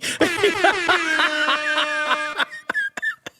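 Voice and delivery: long high-pitched laughing fit; real laughter. Real, not acted. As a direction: laugh helplessly at your own bit. Laughing at the tattoo joke.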